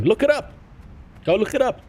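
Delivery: high-pitched, rising insistence; high, rising urging; insistent challenge. Real, not acted. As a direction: pitch it way up, daring them to check. Challenging a doubting viewer to check.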